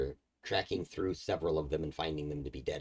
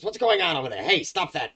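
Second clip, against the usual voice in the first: much higher, loud hag shout across the room; hag yelling; 11 semitones higher, 9 dB louder than usual. Acted. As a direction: scold the brawling dretches from afar. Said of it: "the hag sort of turns around and she goes".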